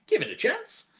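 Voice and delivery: high, loud insistence; impatient father. Acted. Husband hushing his wife, high and loud. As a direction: snap back at the wife, high. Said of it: "said Mister Cranky".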